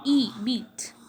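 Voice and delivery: "E" held alone, then "beat" with the same ee; vowel then example. Shown as a demonstration. Teacher matching vowel to word, "E" then "beat" separately sounded. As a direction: isolate "E", then place it in "beat". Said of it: "as in".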